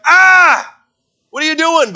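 loud, high cry of frustration; acted hunter's outburst, easing off at the end; exasperated mock yell. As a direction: wail at the deer that got away. Frustrated hunter as deer runs.

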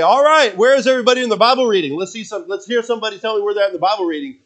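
high, loud, fast pastor calling out; mock pastor calling on the room; imitating old pastor. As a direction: ham up the pastor prodding the congregation. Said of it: "He'd say".

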